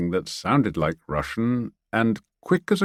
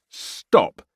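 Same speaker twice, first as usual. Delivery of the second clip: a second, slightly louder shouted order; yell; 3 dB louder than usual. Acted. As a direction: stop them with a yell. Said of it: "he yelled again".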